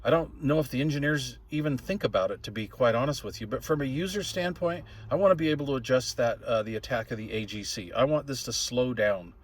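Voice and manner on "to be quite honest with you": ordinary talk, nothing marked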